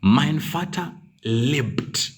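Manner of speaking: very slow, "mein" stressed; slow correct form. Shown as a demonstration. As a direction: read the right form slowly. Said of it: "but instead you say".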